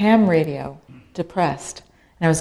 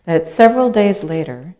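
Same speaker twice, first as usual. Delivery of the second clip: usual speaking voice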